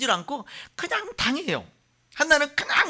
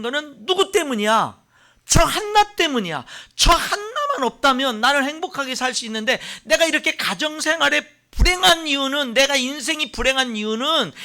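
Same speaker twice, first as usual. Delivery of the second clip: louder, higher accusing rant; bitter blaming; 5 semitones higher, 5 dB louder than usual. Acted. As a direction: voice the rival's bitter blame. Preacher voices Peninnah's blame. (원망하며 탓하는 목소리)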